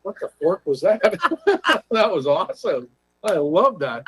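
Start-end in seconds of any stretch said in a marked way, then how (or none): 1.1-1.9 burst of laughter. Real, not acted. Laughs at the mentor's reply.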